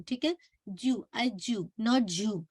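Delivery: ordinary talk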